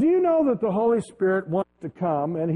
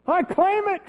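much higher and louder, triumphant cry; joyful shout; 13 semitones higher, 5 dB louder, slower than usual. Retold or imitated. Pastor hearing his record can be cleared, shouting for joy. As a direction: burst out in victory. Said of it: "And I said".

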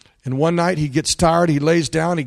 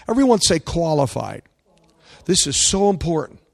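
ordinary talk

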